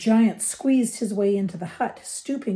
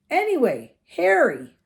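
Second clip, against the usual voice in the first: hearty giant; 7 semitones higher, 5 dB louder than usual. A narrator's reading. Reads the giant's dialogue.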